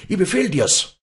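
high, loud, enraged command; angry shout. Acted. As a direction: shout the order in a burst of temper. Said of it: "schrie Walli in ausbrechendem Zorn". (zornig herausgeschriener Befehl)

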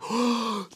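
surfacing gasp, high and breathless; sharp gasp for air. A narrator's reading. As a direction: gasp as you come up out of the water. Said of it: "фыркая и отплёвываясь".

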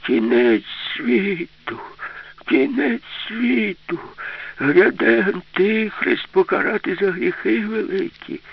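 slow, high, loud doomsday wail; drawn-out, high-pitched frenzied warning; frenzied, thin cry. Acted. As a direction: cry out shrilly like a frantic old prophet. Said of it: "закричав несамовито й тонко".

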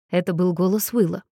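ordinary delivery, nothing marked

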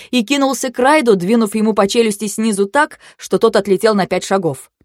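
ordinary delivery

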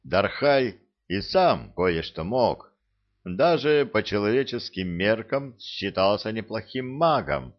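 plain reading voice

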